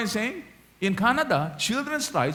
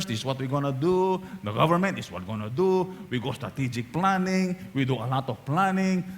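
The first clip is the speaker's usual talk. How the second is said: lightly voiced, lower corporate planner voice; a hint of a confident executive; business thinkers; 6 semitones lower, 3 dB quieter than usual. Pastor mimicking business thinkers at planning. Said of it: "Yung mga business thinkers".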